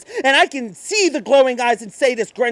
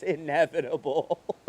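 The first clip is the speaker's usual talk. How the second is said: unhinged laugh; 4 semitones lower than usual. Acted. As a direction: crack into a mad laugh. Madness laugh.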